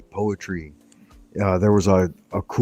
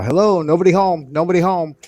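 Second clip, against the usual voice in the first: high, loud parrot call; parrot imitation; 9 semitones higher, 4 dB louder than usual. Parrot answering the door, high imitated bird call. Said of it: "it would always say".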